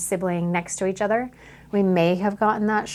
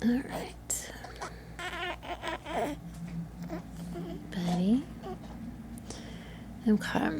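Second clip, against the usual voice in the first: soft whisper; 7 semitones higher, 13 dB quieter than usual. Real, not acted. Photographer whispering to newborn, hushed. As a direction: barely breathe the words. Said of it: "soothing newborn during photo shoot".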